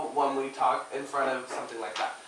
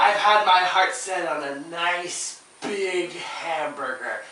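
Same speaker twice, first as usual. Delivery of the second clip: drawn out, slowed, savoring each word; savoring the hamburger; 3 semitones higher, 7 dB louder, slower than usual. Shown as a demonstration. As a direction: make them taste it in your mouth. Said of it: "you have to taste the hamburger in your mouth".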